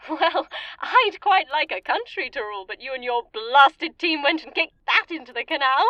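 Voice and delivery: indignant rant. Acted. Villain complaining to Paul that his team wrecked her rule, indignant. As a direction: berate them for ruining her plans.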